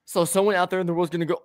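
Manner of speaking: ordinary talk, nothing marked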